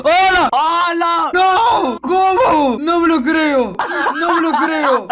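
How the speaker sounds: loud, high-pitched disbelieving cries; real high, loud burst of amazement; amazed shouting. Real, not acted. Lands a lucky jump, amazed.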